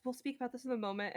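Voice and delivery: soft, hurried aside; quiet composure. Acted. Nat wiping tears away before tending the horse, quiet composure. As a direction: set it aside softly and quickly. Character puts the matter off.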